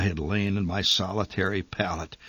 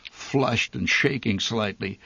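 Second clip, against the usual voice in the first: ordinary delivery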